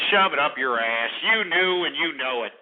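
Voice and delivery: usual speaking voice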